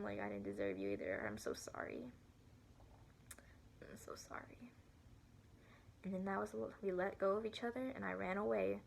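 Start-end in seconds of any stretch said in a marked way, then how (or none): 0.2-2.1 retold crying apology. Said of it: "started crying". 3.8-4.7 barely voiced, much softer whisper; whispered apology. Girl tearfully whispers sorry to her former best friend. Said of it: "started crying".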